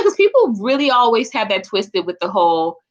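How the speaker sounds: normal talking voice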